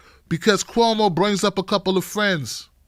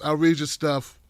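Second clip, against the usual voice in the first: ordinary talk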